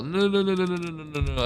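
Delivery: panicked no's. Real, not acted. Enemy ships about to attack.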